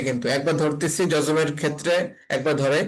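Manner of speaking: ordinary delivery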